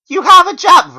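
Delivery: very high, loud protest; shrill denial; frantic protest. Acted. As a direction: protest in disbelief. Narrator protests.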